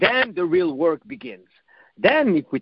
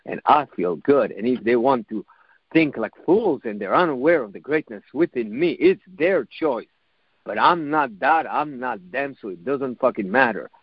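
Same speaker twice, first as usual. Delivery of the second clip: lower-pitched, steady self-talk; self-assured inner voice; 8 semitones lower than usual. Acted. Inner voice answering someone gossiped about, self-assured and dismissive. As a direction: brush it off in a low, assured inner voice. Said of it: "another voice kicked in gear and said".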